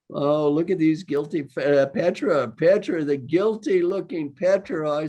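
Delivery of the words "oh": ordinary delivery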